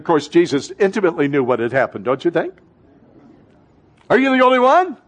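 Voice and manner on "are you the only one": pitched up in disbelief; can you believe this guy; incredulous question. Acted. Disciples asking the stranger, incredulous and higher.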